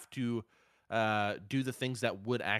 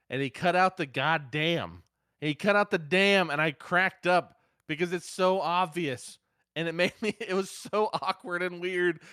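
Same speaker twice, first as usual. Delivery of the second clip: higher and louder, laughing as he tells it; amused disbelief, louder and higher; excited laughing retell; 7 semitones higher, 6 dB louder than usual. Real, not acted. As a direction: crack up at the bleeped curse. Cracking up retelling the cut.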